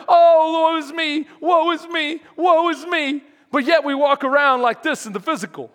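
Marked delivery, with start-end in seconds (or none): 0.1-3.2 loud, high mock lament; a loud, hammed-up moan about life's trials; self-pitying wail. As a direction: ham up a theatrical woe-is-me. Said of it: "we start to".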